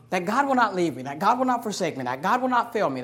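ordinary talk

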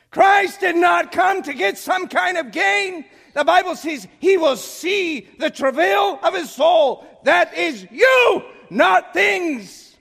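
high, full-volume pulpit shouting; screaming preaching. Real, not acted. Preacher urging souls over passing things, screamed sermon. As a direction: drive each phrase home at a shout. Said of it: "I scream. I'm sorry".

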